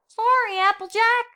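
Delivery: put-on high, apologetic young filly voice; little filly Apple Bloom. A narrator's reading. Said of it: "said Apple Bloom".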